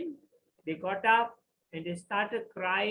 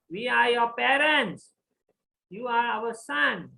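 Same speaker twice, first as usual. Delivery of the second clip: desperate plea; 6 semitones higher, 12 dB louder than usual. Acted. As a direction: make the son recognise them. King pleads with revived son.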